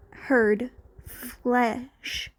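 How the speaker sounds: plain reading voice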